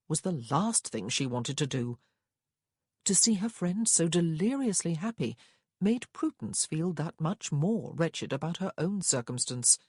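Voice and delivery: ordinary delivery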